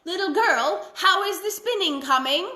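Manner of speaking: put-on high, loud calling old witch; lightly voiced witch calling out. A narrator's reading. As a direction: call sweetly through the window as the witch. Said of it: "she just called out".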